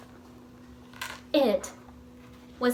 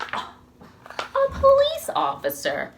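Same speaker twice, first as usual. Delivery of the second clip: surprised reveal; slower than usual. A narrator's reading. Reveal of visitor.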